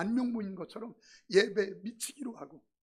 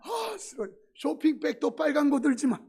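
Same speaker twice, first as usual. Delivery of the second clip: put-on higher, louder, childish sulk; petty whine; 6 semitones higher, 8 dB louder than usual. Pastor hams up his petty grudge against red bags.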